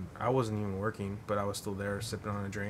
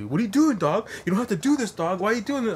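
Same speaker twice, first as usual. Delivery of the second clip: high, loud whiny protest; mock panicked whine, much higher and louder; whiny pleading; 11 semitones higher, 8 dB louder than usual. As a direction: squeal it like a guy being dragged downstairs. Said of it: "And he's like".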